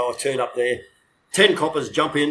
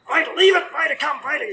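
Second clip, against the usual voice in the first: yelling at the dog; 14 semitones higher than usual. Retold or imitated. Handler shouting at his dog chasing a chicken. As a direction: bellow at the dog to leave it. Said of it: "yelling at the dog to come back".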